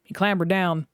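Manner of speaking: usual speaking voice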